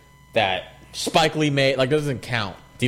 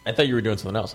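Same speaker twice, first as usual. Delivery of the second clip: normal talking voice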